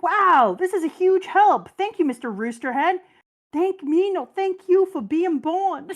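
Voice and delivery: high, loud overjoyed gratitude; gushing anime voices. Retold or imitated. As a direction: squeal the thanks like a fangirl. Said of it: "Luffy is like".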